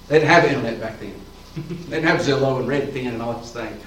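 ordinary talk

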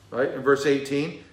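ordinary delivery

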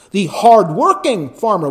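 hard-working lifted and leaned on; stress on hard-working. Shown as a demonstration. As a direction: hit hard-working as you read it. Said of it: "you emphasize the word".

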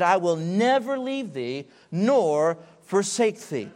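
slow emphatic promise with never stressed. Acted. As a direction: lean into never, slowly. Quoting God's promise.

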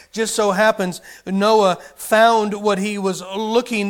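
"found" leaned on, slow delivery; slow, with the weight on "found"; stress on found. Shown as a demonstration. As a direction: hit the word "found". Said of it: "The emphasis is on the word found".

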